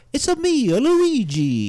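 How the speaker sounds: high, loud cartoon Italian; Luigi. Showing how easy the catchphrase is, high bouncy Italian voice. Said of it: "it's easy to go".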